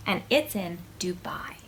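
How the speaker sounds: whispered tag-on. Acted. Reader whispering the place to the child. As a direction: drop to a secret whisper. Whispered aside in kids' book.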